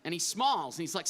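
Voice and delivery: ordinary delivery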